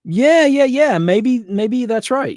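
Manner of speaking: high, loud, slowed yeahs; excited agreement. Real, not acted. Answering Aiden in the chat, high excited agreement. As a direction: eagerly agree with the viewer.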